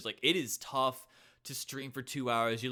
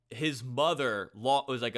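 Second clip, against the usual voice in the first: usual speaking voice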